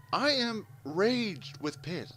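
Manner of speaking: high, slow, emphatic recounted anger; retold rage. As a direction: stretch out the fury, half-comic. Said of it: "I'm pissed".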